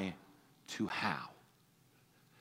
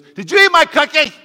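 put-on much higher, louder little child accusing; accusing toddler; 14 semitones higher, 18 dB louder than usual. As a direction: play a toddler demanding an answer. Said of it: "ask you a question with an accusing tone".

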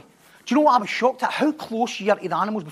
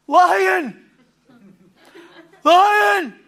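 two sudden high, loud yelps of "Lion!"; a startled warthog shrieking the alarm; panicked warthog cry; 7 semitones higher, 5 dB louder than usual. Retold or imitated. Warthog looks up from eating and cries out in alarm. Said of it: "and it'll go".

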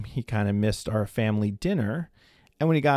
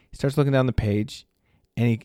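ordinary delivery

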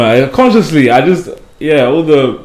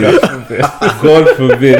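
mock horror through laughter; laughing; 3 semitones higher, 3 dB louder than usual. Real, not acted. Joking to friends about Canadian accent, laughing. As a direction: laugh while rejecting the very idea.

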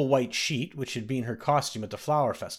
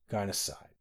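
ordinary delivery

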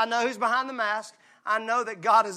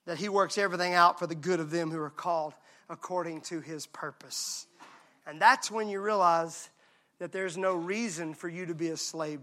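much softer, low and intimate; hushed and tender; 6 semitones lower, 9 dB quieter than usual. Real, not acted. Preacher comforting the church in a soft, low voice. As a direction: lean in and comfort them quietly.

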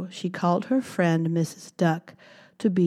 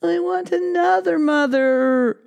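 higher, whiny demand; whiny baby mouse; 11 semitones higher, 5 dB louder than usual. Acted. Baby mouse demands a new mother, high whine. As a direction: whine it like a sulky child. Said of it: "cried Baby Mouse".

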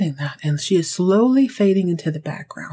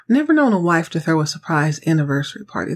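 ordinary talk

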